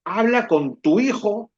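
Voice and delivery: a fed-up mother demanding; mom's demand. Retold or imitated. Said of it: "y me dice". (madre harta que exige, con voz más aguda)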